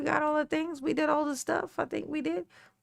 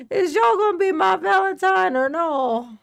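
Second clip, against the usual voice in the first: loud, high demand for an answer on "Is y'all gonna be my Valentine or no?"; questioning; 6 semitones higher, 5 dB louder than usual. Real, not acted. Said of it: "I said it like it was a question".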